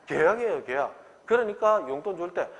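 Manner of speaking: usual speaking voice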